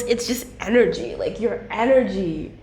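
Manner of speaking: usual speaking voice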